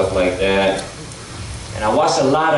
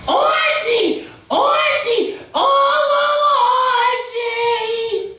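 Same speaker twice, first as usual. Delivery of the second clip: shrill high falsetto calling Archie; Edith calling Archie; much slower than usual. Retold or imitated. Impersonating Edith calling Archie at the door, high falsetto. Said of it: "you have Edith coming to the door".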